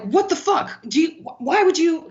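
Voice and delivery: high-pitched, sputtering disbelief; acting out an angry boss, tripping over words; outraged boss. Boss confronts employee, acted out.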